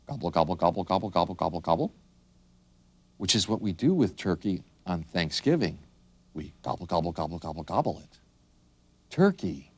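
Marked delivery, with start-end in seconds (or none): 0.1-1.9 rapid run of gobbles; turkey gobble. Shown as a demonstration. Teacher showing how a turkey goes, rapid gobbling. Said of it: "A turkey goes". 6.6-8.0 soft, rapid gobbling; turkey gobble. Shown as a demonstration. Teacher joking about Thanksgiving turkey, gobbling it up. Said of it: "A turkey goes".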